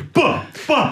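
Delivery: very high, laughing mock-rapper goodbye; generic rapper. Artist joking how all rappers talk, laughing parody. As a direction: parody every rapper's sign-off. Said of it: "așa vorbesc toți rapperii".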